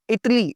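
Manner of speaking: "इटली" with first syllable stressed, no long aa; correct pronunciation. Shown as a demonstration. Teacher modelling the country name, "इटली" as IT-lee. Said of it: "इटाली नहीं".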